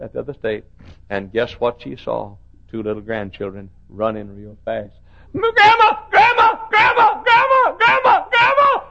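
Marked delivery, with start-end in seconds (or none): 5.3-8.8 high, loud, excited shouts of Grandma; children calling out. Retold or imitated. Grandchildren running at the airport, excited shouts. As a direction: call out like children running to her.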